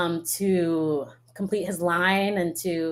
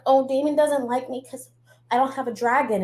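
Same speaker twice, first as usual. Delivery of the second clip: mocking whiny voice; 5 semitones higher than usual. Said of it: "where she's like".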